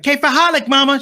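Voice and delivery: excited son proudly announcing; high, loud, excited burst; eager announcement. Retold or imitated. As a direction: blurt it out high and loud to mom. Eager greeting to mom, retold.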